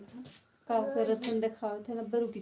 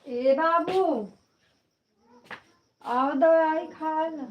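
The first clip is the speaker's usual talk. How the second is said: higher and slow, gently coaxing; coaxing mother; 4 semitones higher, slower than usual. Real, not acted. Mother gently coaxing her child to take medicine. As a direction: sweet-talk the little one into taking the medicine.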